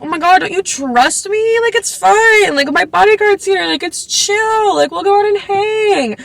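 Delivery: put-on a laid-back boy talking a worried mom into it; coaxing bandmate voice. Voicing Simon coaxing the mom.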